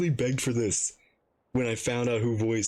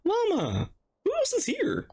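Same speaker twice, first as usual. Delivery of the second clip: excited. Real, not acted. Streamer greeting familiar characters.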